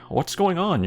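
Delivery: high, lightly voiced mother; mother's voice. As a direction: pitch up for a mock mom. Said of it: "your mother asks".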